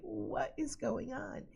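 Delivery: high, soft, drawn-out bafflement; baffled. Retold or imitated. Puzzled customer facing staring restaurant staff, high baffled voice. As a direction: ask it high-pitched, slow and quiet, mock bewildered.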